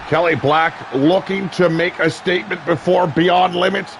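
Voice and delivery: hyped wrestling announcer riding every big move; live play-by-play. Acted. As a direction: call the match live with big excited shouts. Live wrestling match commentary.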